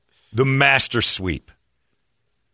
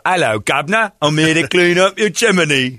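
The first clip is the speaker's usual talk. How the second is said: a hint of a Cockney accent, louder; Cockney chimney sweep; 5 semitones higher, 4 dB louder than usual. Host imagining a Cockney chimney sweep at his door. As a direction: ham up a Cockney sweep greeting. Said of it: "He's got a Cockney accent".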